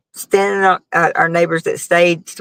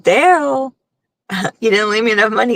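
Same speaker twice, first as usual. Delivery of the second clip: whiny complaint; 4 semitones higher than usual. Retold or imitated. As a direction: act the complaint out more strongly the second time. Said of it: "it's like".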